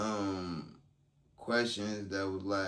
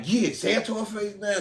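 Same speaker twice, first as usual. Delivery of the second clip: higher and louder, goading demand; mock pushy lawyer; 11 semitones higher, 6 dB louder than usual. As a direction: imitate the lawyer egging her on, raised up. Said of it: "He like".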